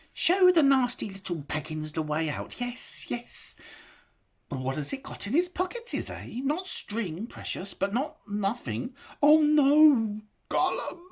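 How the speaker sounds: high, fast wheedling creature voice; a sly greedy creature sizing up his prey; Gollum, sly. A narrator's reading. Gollum scheming over Bilbo's pockets, high creature voice.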